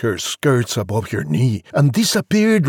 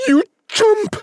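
very high, hammed-up insult; high-pitched comic fury; mock yell. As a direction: yell it as an exasperated mum, pitch shot up. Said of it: "She yelled".

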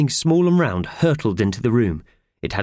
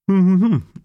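humming through a mouthful; mumbled around porridge; mouth full; 12 semitones higher, 6 dB louder than usual. Acted. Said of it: "shoveling porridge into his mouth".

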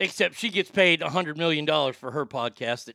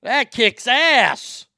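excited; 5 semitones higher, 6 dB louder, much slower than usual. Real, not acted. Savoring the good news.

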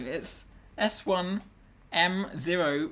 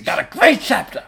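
Al Pacino impression; 3 semitones higher, 13 dB louder than usual. Cohost joins Pacino bit.

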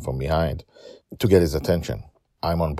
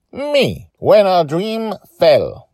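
put-on higher, proud little girl's claim; 8 dB louder, slower than usual. Joy proudly answers her dad. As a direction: stand tall and claim it. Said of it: "she says proudly".

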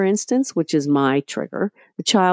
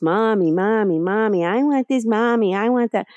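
put-on higher, whiny kid nagging mommy; whiny child; 5 semitones higher than usual. Mother mimicking her nagging toddler, high and whiny. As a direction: mimic a kid pestering for things.

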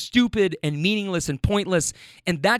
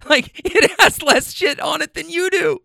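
laughing; 10 semitones higher, 4 dB louder than usual. Real, not acted. Laughing at own insult.

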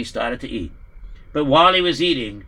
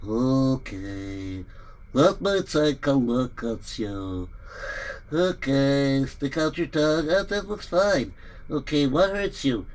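calm doctor; 3 semitones lower, 5 dB quieter, slower than usual. Acted. Doctor examining patient.

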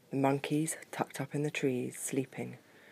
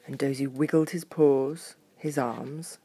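usual speaking voice

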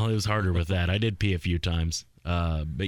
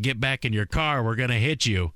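higher-pitched mock yell out a car window; retold yelling; 6 semitones higher than usual. Frazzled driver warning people outside his car, retold mock yell. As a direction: imitate a driver calling out, not at full volume.

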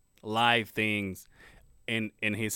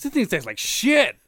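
disgusted outburst; 9 semitones higher, 7 dB louder than usual. Acted. Joke customer disgusted by popsicle.

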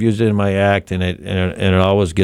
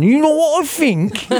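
granddaughter's catchphrase. Retold or imitated.

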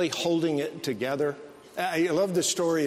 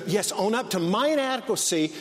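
ordinary talk, nothing marked

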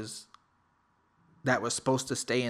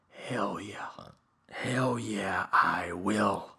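low defiance; 3 semitones lower, much slower than usual. Retold or imitated. Low defiant answer to dare.

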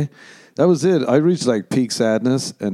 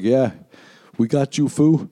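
a hint of a street tough, higher; mock gang bravado; tough fan; 4 semitones higher than usual. Fans after a show reassuring the comic, lightly voiced tough guy. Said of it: "They're like".

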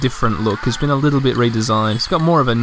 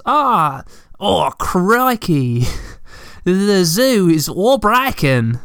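drawn-out, higher mock-Australian voice; 4 semitones higher, much slower than usual. Game character announcing the broken zoo, hammed-up Australian. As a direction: ham up a slow Aussie "crikey" accent. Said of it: "the Australian stereotype".

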